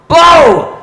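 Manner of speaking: a sudden high, loud yelp of "Bo!"; cue shout. Retold or imitated. Bandleader calling the sax player into his solo, a high loud shout. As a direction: bark the cue to jump into the solo. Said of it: "he'd go".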